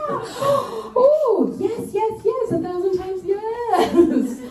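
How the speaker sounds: high, slow, sing-song swooning yes; ecstatic yes. Retold or imitated. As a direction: gush it like accepting a proposal. Ecstatic answer to proposal.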